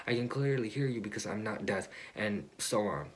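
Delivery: normal talking voice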